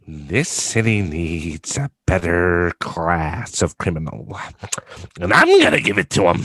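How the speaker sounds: drawn-out villain voice, swelling at the end; Heath Ledger's Joker. Acted. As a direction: do a slow, theatrical Joker, building up. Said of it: "hear your Heath Ledger impression".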